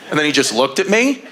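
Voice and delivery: ordinary talk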